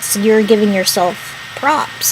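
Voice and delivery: normal talking voice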